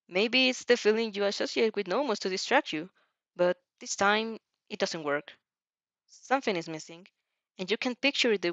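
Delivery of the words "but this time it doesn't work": ordinary delivery, nothing marked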